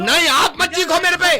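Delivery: very high, loud angry yell; shouting. Acted. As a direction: shout him down. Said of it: "मेरे से क्यों चीख रहे हो". (गुस्से में ज़ोर से चीखते हुए)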